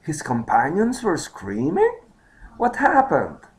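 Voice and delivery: high-pitched surprised questioning. Acted. Second man hears of screaming companions, surprised. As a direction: ask it high, in disbelief. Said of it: "The second man is surprised".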